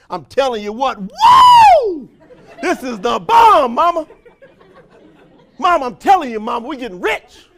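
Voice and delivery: loud, high whooping excitement; mock over-the-top hype; hyped shout. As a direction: whoop it to mama. Hyped member shouting to mama.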